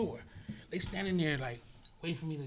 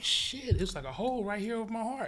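retold startled shout, higher; shocked discovery; 7 semitones higher than usual. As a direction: react as if you just found the hole. Discovering bullet hole over heart.

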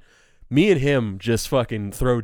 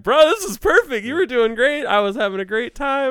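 very high, loud gushing reassurance; exaggerated reassurance; 6 dB louder than usual. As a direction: gush at him, pitch way up.

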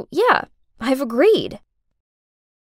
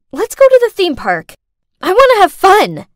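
high, loud burst of eagerness; bold, raised-voice announcement; excited declaration; 7 semitones higher, 7 dB louder than usual. Acted. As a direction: blurt it out loudly, ready to break free. Acted impulsive demand in car.

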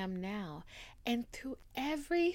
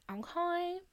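high squeak; 10 semitones higher than usual. Shown as a demonstration.

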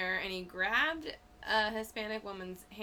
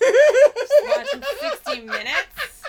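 laughing; 18 dB louder, slower than usual. Acted. Mocking old viewers, laughing.

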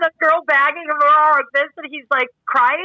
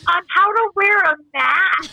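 slow, higher-pitched announcement echo; mock PSA; 7 semitones higher, slower than usual. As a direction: spell it out slowly like a public notice. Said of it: "there needs to be a P S A".

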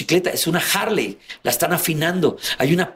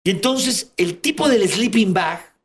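ordinary talk, nothing marked

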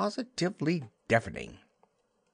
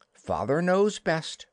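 Wendy's prim line; 6 semitones higher, slower than usual. Acted. Wendy voiced, slow.

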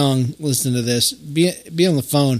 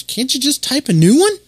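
Jenna's question; 11 semitones higher, 4 dB louder than usual. Retold or imitated. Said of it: "She goes".

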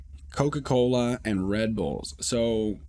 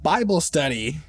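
put-on a touch higher, accented Bible study; mild mock accent, matter-of-fact; accented announcement; 6 semitones higher, 4 dB louder than usual. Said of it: "they've got a heavy accent".

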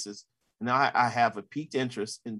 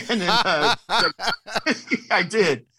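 higher, louder, laughing through the words; laughing admission; 8 semitones higher, 6 dB louder, slower than usual. Real, not acted. Reporter admitting his reaction to Beijing, laughing. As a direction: break up laughing while owning up.

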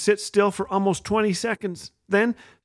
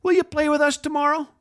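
put-on higher, hopeful child's voice; little girl asking; 10 semitones higher than usual.

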